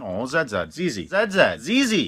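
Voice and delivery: "Z Z" said as "zed zed", tried several times; Zed pronunciation. Shown as a demonstration. Correcting a friend, says "Z Z" as zed zed. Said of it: "Don't you mean Z Z?".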